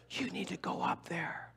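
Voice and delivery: slow pressing whisper; insistent whisper. Retold or imitated. Friends whispering insistently that he must go up. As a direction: whisper it firmly, don't take no.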